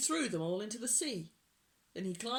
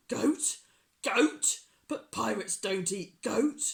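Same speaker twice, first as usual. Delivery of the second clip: loud, outraged pirate; indignant; 3 semitones higher, 9 dB louder than usual. A narrator's reading. Pirate chief rejects goat stew, indignant. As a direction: repeat it in loud disbelief.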